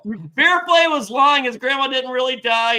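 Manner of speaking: high, loud announcement to the group; calling out. Pretend. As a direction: call it out to the whole camp. Calling out to everyone.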